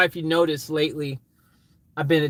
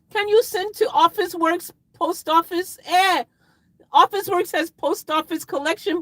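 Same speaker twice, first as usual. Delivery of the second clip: put-on very high, childlike pleading; mock begging; 12 semitones higher, 4 dB louder than usual. Streamer begging viewers to mail a recycled phone, squeaky mock pleading.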